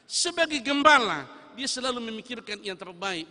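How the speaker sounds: high, loud preaching emphasis on gembala; fervent pulpit call; forceful preaching. Acted. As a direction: proclaim it to the whole congregation. Preacher building to full force.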